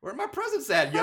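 loud, high cheeky demand; mock demanding kid. Daughter to bloody killer, brash mock demand. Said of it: "she's like".

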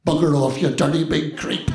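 loud, blunt burst of disgust; indignant rebuff. Acted. Old lady rejecting his helping hand, loudly indignant. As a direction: snap it loudly at an unwanted helper.

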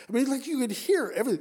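suddenly high and loud, rushing; excited. Real, not acted. Preacher marvelling at sound over water, excited and loud.

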